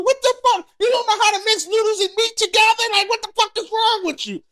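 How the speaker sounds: high-pitched, indignant outburst; acted outrage. Husband going off on his wife over dinner, acted outrage. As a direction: blow up in disbelief, pitch shooting up.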